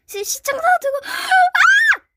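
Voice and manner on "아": sudden high gasping cry; startled shriek. Real, not acted. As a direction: gasp and yelp in shock. Gasp and shriek.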